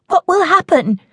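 high, fast, anxious question; frightened daughter asking what happens if they stay; panicky child. Acted. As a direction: let panic push the words.

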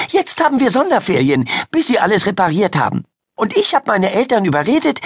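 put-on high, eager girl's chatter; excited storyteller girl. Miriam telling Ida about the storm, excited. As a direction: relish the dramatic news in a high voice. Miriam explains her visit.

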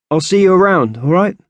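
put-on casual boy voice tossed over the shoulder; offhand goodbye. Gray brushing Isis off over his shoulder, lightly voiced boy. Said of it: "throwing a last comment over his shoulder".

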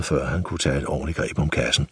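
ordinary delivery, nothing marked

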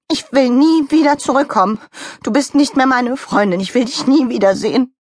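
high, hurt outburst; almost crying. Acted. Donald Rabbit ending the friendship, tearfully. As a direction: sound close to tears while breaking off a friendship. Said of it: "als ob er weinte".

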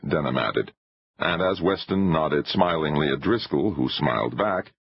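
usual speaking voice

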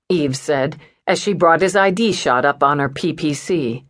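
plain reading voice

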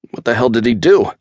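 high, loud frightened squeal; high-pitched squeal. A narrator's reading. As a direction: squeal it out in panic. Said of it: "Chad's high-pitched squeal".

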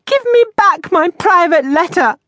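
high, loud, insistent demand; outraged cry. A narrator's reading. Miss Moss calls after retreating landlady, high loud demand. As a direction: shriek for it back, indignantly.

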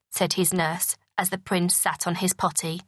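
ordinary delivery, nothing marked

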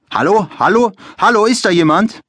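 high, loud calling through a door. A narrator's reading. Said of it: "rufen die Menschen da draußen".

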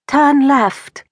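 a boy mimicking a posh British sat-nav; falsetto British accent. Ike playing the GPS lady to his mom, falsetto British accent. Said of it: "in a falsetto British accent".

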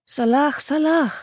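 high, strange call out of the fog; eerie high call from the mist. A narrator's reading. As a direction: sing it out like a ghostly cry. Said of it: "rief der Schatten ihm unwillkürlich zu".

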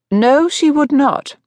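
high, drawn-out firm refusal; determined interruption. A narrator's reading. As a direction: cut in firmly, pitched high and slow. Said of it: "determinedly forestalling him".

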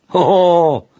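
put-on high, emphatic old man's exclamation; exclaiming. A narrator's reading. Grandfather answers whether that family is powerful, exclaiming. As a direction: cry out, pitched well up. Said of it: "zawołał dziadek".

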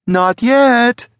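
high, loud shrill yell; Sally, shrill yell. A narrator's reading. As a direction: yell it shrilly while giving chase. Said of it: "Sally yelled shrilly".

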